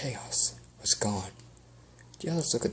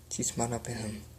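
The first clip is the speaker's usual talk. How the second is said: usual speaking voice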